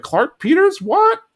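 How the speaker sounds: very high mock-shocked question; Min. Mother reacting to her daughter's news, very high mock shock. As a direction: shoot the pitch up in put-on disbelief. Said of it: "Min's like".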